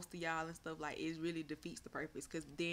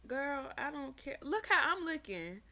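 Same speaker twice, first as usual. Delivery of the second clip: sassy; 8 semitones higher, 4 dB louder, slower than usual. Acted. Reading a chat comment aloud.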